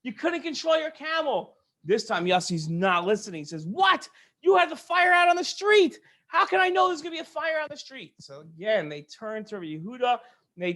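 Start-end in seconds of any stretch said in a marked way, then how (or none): 0.0-1.6 furious. Acted. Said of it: "the store owner is furious". 3.7-8.1 high, loud indignant protest; indignant shout. Acted. Yossi shouts back at the furious store owner.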